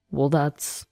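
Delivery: low, soft, faltering stammer; falter, lost for an answer. A narrator's reading. Ex-husband cornered by her question, stammering. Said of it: "Jake stammered".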